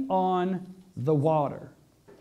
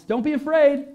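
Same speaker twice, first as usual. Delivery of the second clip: raised, higher call; calling out reassurance; 7 semitones higher, 4 dB louder than usual. Acted. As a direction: call across to frightened friends. Jesus calls to frightened disciples.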